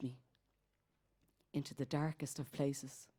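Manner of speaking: low, soft, with long pauses; hushed poetic recital. Acted. Telling where gold and healing are found, low and hushed. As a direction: drop right down and let each phrase hang. Poem drops after climax.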